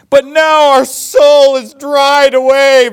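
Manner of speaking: loud, high, drawn-out mock wail; crying complaint. Congregation hears the people bemoan their food, mock crying. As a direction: wail it slowly like an overdone lament. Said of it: "they're crying over their food".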